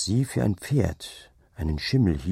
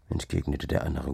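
ordinary delivery

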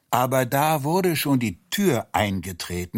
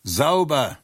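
louder, higher barked command; mock bellow; 5 semitones higher, 4 dB louder than usual. Soldier at the raid yells the order, loud. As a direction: shout the order. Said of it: "jemand brüllte".

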